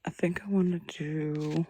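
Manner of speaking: unvoiced, breathy half-whisper. Streamer whispering her plans while thinking. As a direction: murmur the thought to yourself.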